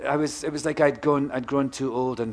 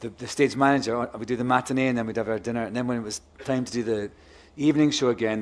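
ordinary talk, nothing marked

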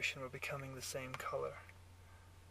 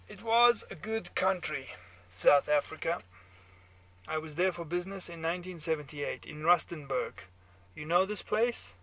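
put-on loud German-accented reminiscing; 3 semitones higher, 8 dB louder than usual. A narrator's reading. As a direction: hold forth in a German accent. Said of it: "your German accent".

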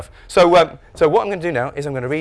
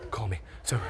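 usual speaking voice

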